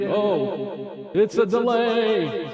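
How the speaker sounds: high, drawn-out mock wonder; sing-song call into the echo; playful echo demo. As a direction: stretch each word up high, playfully. Showing off echo effect, playfully.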